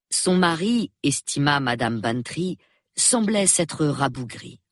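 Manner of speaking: ordinary delivery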